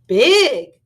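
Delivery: booming "Big!"; a dinosaur marvelling at a mountain, loud; loud exclaim. Acted.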